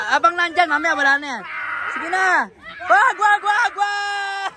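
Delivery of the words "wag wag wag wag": higher, shouted repeated warning; urgent warning shout. Real, not acted. Warning friends at the jump, urgent shout. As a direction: yell to stop them at once.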